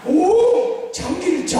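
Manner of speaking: high "오", then a dismissive name; knowing scoff. Shown as a demonstration. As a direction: sound like you know it all already. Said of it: "오, 이 간단한 소리 해야 돼요". (다 안다는 듯 높은 "오")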